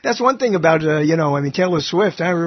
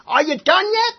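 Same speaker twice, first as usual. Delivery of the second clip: high, playful asking voice; mock question voice; 12 semitones higher, 3 dB louder than usual. Podcast host acts out a joke dialogue, high playful voice. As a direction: tease it out like a skit. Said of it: "It says".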